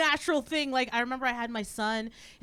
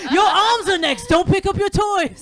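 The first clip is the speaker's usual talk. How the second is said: high, loud hammed-up threat; mock dire warning; 8 semitones higher, 12 dB louder than usual. Comedian mother scaring son about his arms, mock-dire shout. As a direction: shout a warning to frighten a kid.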